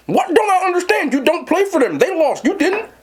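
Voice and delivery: high, exasperated pushback; retelling a teen argument, worked up and disbelieving; incredulous retort. Retold or imitated. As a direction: argue she isn't on the team. Retold argument with girlfriend.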